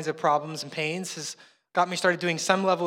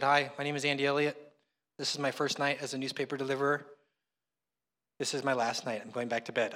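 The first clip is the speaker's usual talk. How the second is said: lower, softer mock video diary; understated groggy retelling; 4 semitones lower, 7 dB quieter than usual. New paper deliverer to camcorder at night, low and subdued. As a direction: say it low, like just woken up. Replays his sleepy midnight camcorder message.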